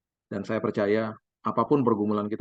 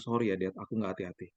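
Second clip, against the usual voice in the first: much softer, lower, small sorry voice; tearful apology; 5 semitones lower, 8 dB quieter than usual. Retold or imitated. Son apologises to his dad, soft tearful voice. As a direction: apologise like a crying boy. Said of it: "Dia nangis sejadi-jadinya".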